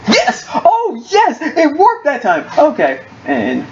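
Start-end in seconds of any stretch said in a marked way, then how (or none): 0.1-2.5 high elated yelp of victory; excited cheer. Real, not acted. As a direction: shout with joy at the win. Celebrating a goal.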